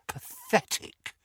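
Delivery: slow, hushed, breathy disbelief; disgusted verdict. A narrator's reading. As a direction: breathe the word out slowly in disdain. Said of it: "he told George".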